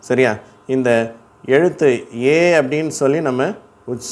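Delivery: ordinary talk, nothing marked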